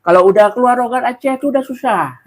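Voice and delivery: high-pitched Acehnese accent. Shown as a demonstration. Demonstrating how Aceh people talk, high Acehnese accent. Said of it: "kayak gitu lah logat Aceh".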